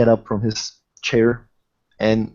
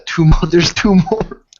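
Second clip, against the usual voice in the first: laughing; 6 semitones higher, 6 dB louder than usual. Real, not acted. Teasing about the extra glasses.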